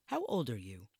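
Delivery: put-on a stern older woman's voice; quizzing him, distrustful; stern matron. Stern matron questions newcomer.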